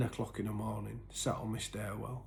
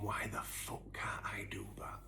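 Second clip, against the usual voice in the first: whispered despair; 8 dB quieter than usual. Retold or imitated. Despairing inner thought, retold.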